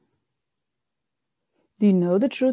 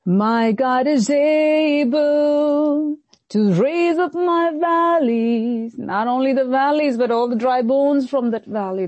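full-force preaching; 3 semitones higher, slower than usual. Acted. Preacher to the congregation, rising, drawn-out proclamation. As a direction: preach it out, building to a cry.

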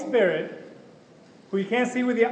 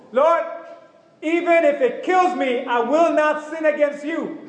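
high, loud, forceful cry to the Lord; determined vow to God; 6 semitones higher, 4 dB louder than usual. Retold or imitated. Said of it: "When I say it like that, God knows".

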